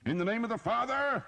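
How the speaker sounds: high, loud priestly battle cry; shout. A narrator's reading. Priest fighting Frenchmen, shouting the blessing. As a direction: bellow the blessing while fighting. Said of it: "he shouted".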